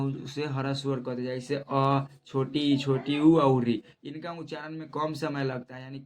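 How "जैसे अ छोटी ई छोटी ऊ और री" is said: each short vowel said clearly and loudly, the last ones slowly; short vowel demo. Shown as a demonstration. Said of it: "जिनका उच्चारण कम समय में होता है". (ह्रस्व स्वर धीरे और ज़ोर से बोलकर दिखाना)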